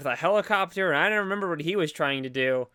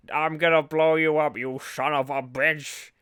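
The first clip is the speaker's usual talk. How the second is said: Richard Nixon voice. Retold or imitated. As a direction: growl it like Nixon. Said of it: "Why did you sound like Richard Nixon".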